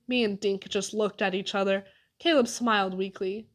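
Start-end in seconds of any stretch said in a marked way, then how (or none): none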